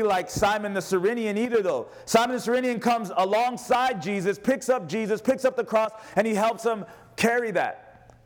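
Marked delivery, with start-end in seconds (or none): none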